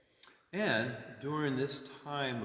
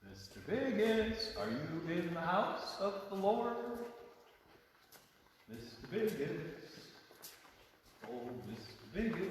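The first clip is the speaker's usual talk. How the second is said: sing-song calling; 8 semitones higher than usual. Pretend. Calling out, fading.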